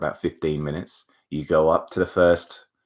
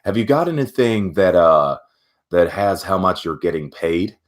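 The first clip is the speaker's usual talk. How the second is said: put-on American accent; 3 dB louder than usual. American interviewer asking about pay, imitation American accent. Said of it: "I should do that in an American accent".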